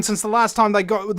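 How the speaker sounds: ordinary talk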